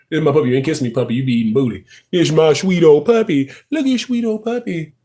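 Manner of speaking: usual speaking voice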